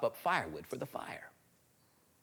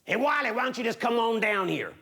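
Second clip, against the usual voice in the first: hairy man; 8 semitones higher, 3 dB louder than usual. Acted. Hairy man calling Wiley down the tree, coaxing. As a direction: lure the boy down in a villain's voice. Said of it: "And the hairy man".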